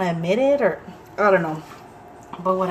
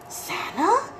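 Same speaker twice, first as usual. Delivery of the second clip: surprised question; 12 semitones higher, 4 dB quieter than usual. Retold or imitated. Son surprised Santa called.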